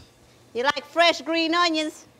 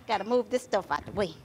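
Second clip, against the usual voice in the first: normal talking voice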